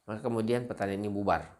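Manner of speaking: ordinary talk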